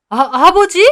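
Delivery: high, loud startled gasp of a word; shocked. Acted. Owner stunned to learn who the old man is, high loud shock. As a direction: caught off guard completely.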